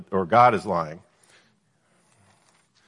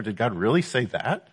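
"really" leaned on, "that" rising in disbelief; doubting emphasis; 3 dB quieter than usual. Shown as a demonstration. Teacher showing how the devil questions God's word, doubting emphasis. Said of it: "some of the emphasis there?".

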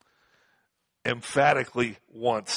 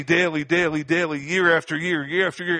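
higher pitched, pounding daily and year after year; emphatic repetition; 5 semitones higher, 4 dB louder than usual. Shown as a demonstration. Preacher stressing the endless old sacrifices, emphatic repetition. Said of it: "The great emphasis here is after daily".

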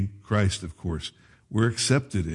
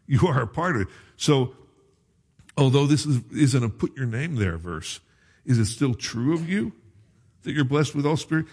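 fervent preaching; 6 semitones higher, faster than usual. Real, not acted. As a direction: drive the point home to the congregation. Preaching builds to full force.

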